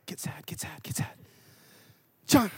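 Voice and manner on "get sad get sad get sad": urgent whisper to yourself; whispered self-coaching. Retold or imitated. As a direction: psych yourself up under your breath. Muttered self-coaching before bad news.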